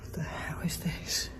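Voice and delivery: a shaken, hushed whisper; frightened whisper. Real, not acted. Alone in the dark, ghost hunter whispers in fright. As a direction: whisper in disbelief, barely daring to speak. Hearing a little girl's voice.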